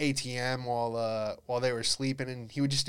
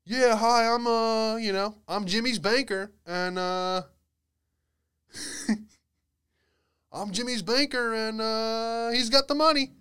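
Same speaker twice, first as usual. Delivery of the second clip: much higher, louder put-on banker voice; fake banker voice; 10 semitones higher, 5 dB louder than usual. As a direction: pose as a polite banker on the phone. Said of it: "answers in the bathroom and goes".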